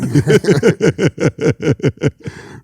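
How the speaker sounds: burst of laughter. Real, not acted. Laughing at own joke.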